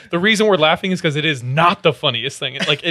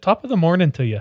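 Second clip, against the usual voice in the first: higher, lilting Irish accent; put-on Irish accent; 5 semitones higher, faster than usual. Shown as a demonstration. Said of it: "if I did an Irish accent".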